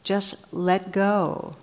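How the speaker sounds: very slow, even and serene; God calmly tells the hiker to let go, very slowly; calm divine answer. Retold or imitated. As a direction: let it fall gently, unhurried. God answers the dangling man.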